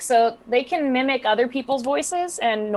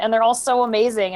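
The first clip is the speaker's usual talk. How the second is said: usual speaking voice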